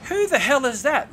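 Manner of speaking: startled, pitch jumping right up; incredulous. Retold or imitated. Startled thought on hearing the ranting.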